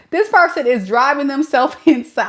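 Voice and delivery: laughing through the words; can't hold back a laugh at them; giggling while mocking the person. Real, not acted. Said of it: "I don't mean to laugh".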